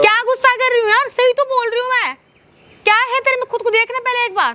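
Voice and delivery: high-pitched, indignant outburst; angry. Acted. Wife denying anger to pleading husband, angry high-pitched retort. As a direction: raise the pitch sharply and lash back. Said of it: "गुस्सा क्यों कर रहे हो".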